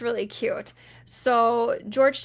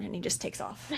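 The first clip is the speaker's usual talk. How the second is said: ordinary talk, nothing marked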